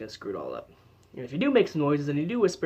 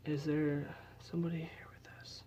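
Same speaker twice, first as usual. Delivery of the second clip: hushed, unvoiced whisper, much softer; 2 semitones higher, 11 dB quieter than usual. Shown as a demonstration. Investigator demonstrating the bad way to question spirits, whispering. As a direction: drop right down to a whisper. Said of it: "I got quieter".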